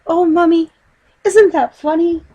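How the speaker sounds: put-on high, loud girlish cry; young girl crying out with glee; excited little girl. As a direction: squeal it like a delighted child. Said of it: "she cried".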